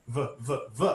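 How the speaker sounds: voiced consonant demo. Shown as a demonstration. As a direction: buzz a voiced "V" so the throat vibrates. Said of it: "you vibrate that F and you get V".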